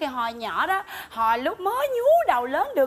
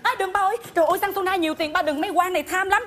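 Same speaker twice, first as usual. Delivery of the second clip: higher, hurried begging; panicked pleading; 5 semitones higher, faster than usual. Acted. Daughter stopping father from smashing the suitcase, frantic pleading. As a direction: plead urgently to stop him.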